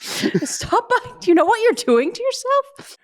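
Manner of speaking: very high mock nag; terrible bartender scolding. Bartender pleading with a customer to stop drinking, high mock scold. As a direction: wag a finger at the customer. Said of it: "I'd just be there going".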